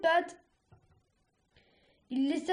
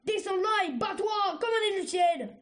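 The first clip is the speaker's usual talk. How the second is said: high, forceful challenge; angry command; 6 semitones higher than usual. A narrator's reading. Cercerelle ordering Argile to come down and fight, angry command.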